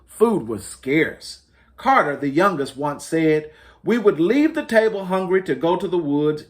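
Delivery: lightly lifted storybook read-aloud; reading to children. Acted. Librarian reading to families, mild storytelling voice. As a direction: tell it gently like a picture book. Reads picture book aloud.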